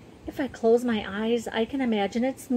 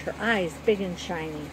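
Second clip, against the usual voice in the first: ordinary delivery, nothing marked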